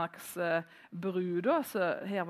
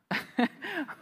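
short, high laugh; amused laugh; 8 semitones higher than usual. Real, not acted. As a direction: laugh it off briefly. Laughs at the compliment retold. (kort, lys latter)

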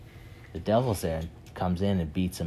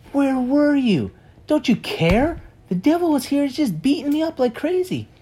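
whiny complaint; 6 dB louder than usual. Retold or imitated. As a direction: wail it out like a wounded victim. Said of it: "and he's like".

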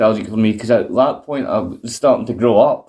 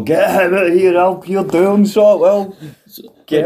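Roddy Piper voice; 8 semitones higher than usual. Retold or imitated. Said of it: "giving it his usual".

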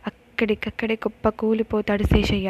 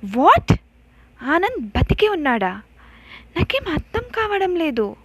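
higher, louder, stunned questions; can't believe what he just heard; shocked disbelief; 8 semitones higher, 6 dB louder than usual. Acted. Aravind hears Anand survived, stunned and disbelieving.

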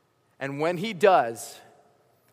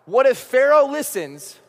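anxious outburst; 6 semitones higher, 7 dB louder than usual. Acted. Moses frantically argues with God.

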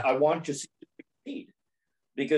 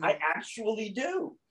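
amused; 8 semitones higher, 4 dB quieter than usual. Real, not acted. Laughing confirmation.

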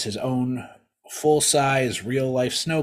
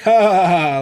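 hammed-up canned laughter, much higher and louder; mock the fake studio audience laugh; sitcom laugh track; 9 semitones higher, 12 dB louder than usual. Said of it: "a laugh track that goes like".

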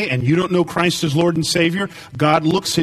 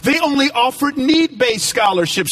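raised, ringing preacher voice; full-force preaching; 9 semitones higher than usual. Acted. Preacher telling the church about a scholarship, at full force. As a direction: build it up like a revival sermon.

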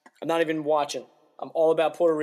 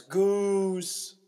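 mock yell; 5 semitones higher than usual. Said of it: "she yells".

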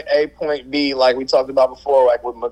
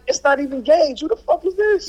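much higher, incredulous retold cry; baffled outburst; 13 semitones higher than usual. Retelling his fantasy loss to his co-host, a high, incredulous cry. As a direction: squeal it out in disbelief. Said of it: "And then I'm like".

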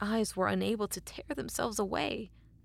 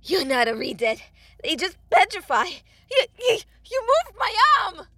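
high, loud, tearful cry; agonised panic; 12 semitones higher, 16 dB louder than usual. A narrator's reading. Blacksmith with broken arm accusing the creature, anguished. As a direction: cry it out through the pain, voice climbing.

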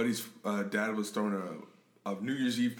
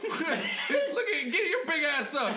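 mocking onlooker; much slower than usual.

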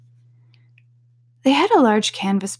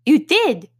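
insistent Hatter; 8 semitones higher, 5 dB louder than usual. A narrator's reading. Hatter and Hare argue.

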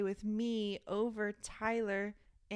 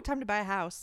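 normal talking voice

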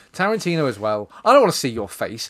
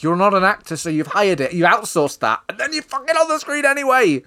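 rising into a loud, high exasperated rant; 8 semitones higher, 4 dB louder than usual. Real, not acted. Podcast host ranting at Tarantino for appearing onscreen, exasperated and loud. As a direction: rant at him louder and higher as you go.

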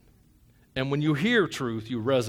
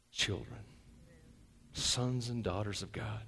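much softer, slow and lower; reverent hush; 6 semitones lower, 9 dB quieter than usual. Real, not acted. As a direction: let each word land gently. Soft slow reverent passage.

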